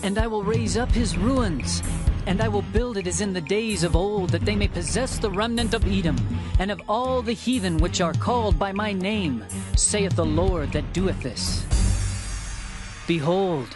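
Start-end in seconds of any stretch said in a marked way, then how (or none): none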